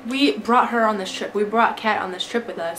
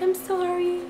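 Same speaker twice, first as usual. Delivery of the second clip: mock sorry friend; 10 semitones higher than usual. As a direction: imitate her high little apology. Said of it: "she's like".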